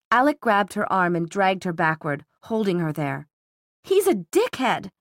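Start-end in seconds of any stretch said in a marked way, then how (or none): 3.8-4.9 high, drawn-out, voiced hiss of contempt; angry hiss. A narrator's reading. Daughter held back by her brother, angry hiss. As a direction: spit the insult out slowly, pitch raised. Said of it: "she hissed".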